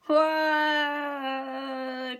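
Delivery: put-on loud childish wail; big teary waaah; crying wail. A narrator's reading. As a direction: wail like a sobbing toddler. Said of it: "cries George".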